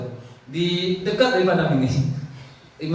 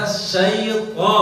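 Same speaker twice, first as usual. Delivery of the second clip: slow demo; 3 semitones higher than usual. Shown as a demonstration. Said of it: "pangkal lisan naikkan".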